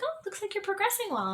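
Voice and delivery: high, cheery mock nurse voice; breezy nurse. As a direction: chirp it like an upbeat nurse. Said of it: "And they were like".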